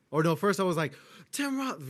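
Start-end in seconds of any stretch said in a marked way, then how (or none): 1.3-1.9 high-pitched starstruck outburst; starstruck freak-out. Retold or imitated. Fan retelling his first sight of actor, freaking out. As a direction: retell freaking out at a celebrity, much higher. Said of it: "you know, freaking out".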